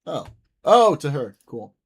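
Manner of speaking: normal talking voice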